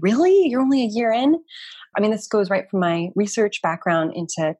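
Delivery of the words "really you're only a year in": high, louder mock disbelief; imitated surprise. Guest retells people's reaction to the year, mock surprised. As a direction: voice other people's astonished question, pitched up.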